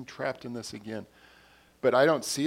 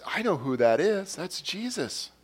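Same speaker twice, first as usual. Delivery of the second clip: retold burst of recognition, higher pitch; excited realization; 7 semitones higher, 4 dB louder than usual. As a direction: act out a sudden, excited discovery. Disciple suddenly recognizing Jesus aloud.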